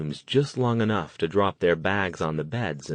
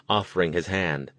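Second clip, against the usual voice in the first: plain reading voice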